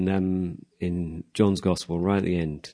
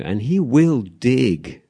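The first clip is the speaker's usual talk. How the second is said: slow emphatic; 4 semitones higher, 4 dB louder, much slower than usual. Real, not acted. Preaching emphasis.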